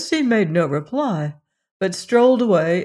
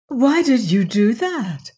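high, louder dismayed cry; 5 semitones higher, 4 dB louder than usual. A narrator's reading. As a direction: call out in dismay. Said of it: "cried the princess".

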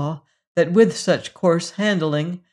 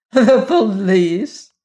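contemptuous echo; 4 semitones higher, 7 dB louder, much slower than usual. A narrator's reading. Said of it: "she echoed, laughed contemptuously".

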